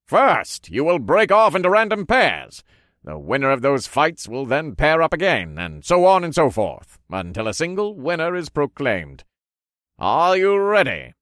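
high, loud showman laying out rules; ring announcer. A narrator's reading. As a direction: announce it to all the fighters.

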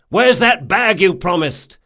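loud, high demand; shout. A narrator's reading. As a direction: call the question out forcefully. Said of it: "he shouted".

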